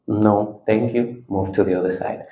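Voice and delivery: low, soft declining; calm refusal. Shown as a demonstration. Teacher sending anger aside, calm refusal. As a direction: wave it away quietly.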